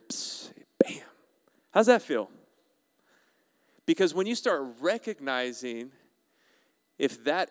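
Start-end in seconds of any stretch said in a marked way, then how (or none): 0.1-2.3 forceful loud stage whisper; taunting whisper. Acted. Preacher playfully hitting Satan, taunting stage whisper. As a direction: whisper it hard, like landing a sneaky blow.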